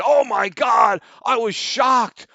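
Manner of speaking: high, loud, mocking shocked whine; sarcastic imitation of fake disgust; mock outrage. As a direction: parody a pearl-clutching critic. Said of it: "and he said".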